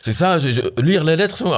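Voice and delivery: high-pitched retold outburst, voice jumping up; losing it. Founder reliving snapping at a developer over paperwork, high exasperated outburst. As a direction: replay the moment you snapped, pitch shooting up. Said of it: "Ah, je pète un câble".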